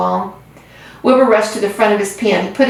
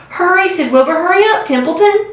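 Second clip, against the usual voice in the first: urgent plea; 9 semitones higher than usual. A narrator's reading. Wilbur urging the rat.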